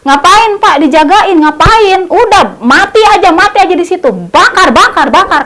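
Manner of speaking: egging the crowd on to burn him; angry villager. Retold or imitated. Villager jeers at the police guarding the thief, loud and angry. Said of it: "yang bilangnya kayak gini".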